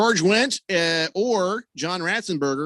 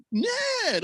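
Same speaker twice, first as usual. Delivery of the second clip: mock Ned call. Imitating Ned's greeting.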